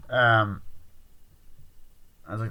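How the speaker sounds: ordinary talk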